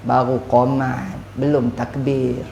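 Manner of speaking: low, soft, slow lazy mumble; husband lazily putting off his wife; lazy brush-off. Retold or imitated. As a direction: brush her off without moving.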